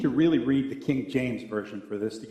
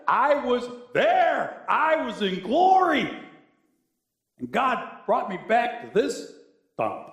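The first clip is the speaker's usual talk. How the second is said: higher, slow, pleading indignation; higher slow exasperation; exasperated Lazarus; 8 semitones higher, 3 dB louder, slower than usual. Retold or imitated. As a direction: Lazarus longing for glory. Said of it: "He says".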